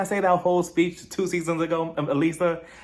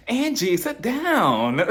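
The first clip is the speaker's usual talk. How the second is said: high, drawn-out mock scolding; exasperated order; 8 semitones higher, 4 dB louder, much slower than usual. Host telling Angie to sit down, exasperated. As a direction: plead in comic exasperation.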